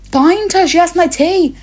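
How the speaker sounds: high, loud, fed-up demand; exasperated outburst. Real, not acted. Student desperate to get the tea back, loud exasperated outburst. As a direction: burst out in frustration.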